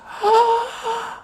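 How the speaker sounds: sharp high shocked gasp; high startled gasp. Acted.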